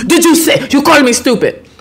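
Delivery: high-pitched imitation of an irate official; retold accusatory outburst; mock indignant. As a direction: repeat the accusation in put-on outrage. Official repeats his angry accusation.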